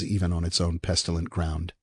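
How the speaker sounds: usual speaking voice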